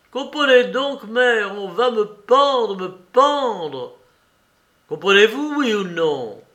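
high, loud, desperate cry. A narrator's reading. Condemned son crying out to his mother, desperate and loud. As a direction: shout it so she finally understands. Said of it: "Vassili cria".

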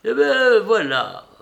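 très aiguë et forte, commère lancée; commère volubile. A narrator's reading. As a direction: attaque fort et haut, prête à tout raconter. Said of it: "poursuivit avec volubilité".

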